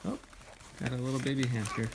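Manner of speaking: ordinary delivery, nothing marked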